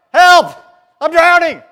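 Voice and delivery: high, very loud shout for help; desperate yell. Shown as a demonstration. Preacher acting out a drowning man, yelling. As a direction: scream it like you're going under. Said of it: "you cry out".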